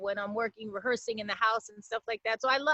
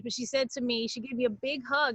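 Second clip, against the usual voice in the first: ordinary delivery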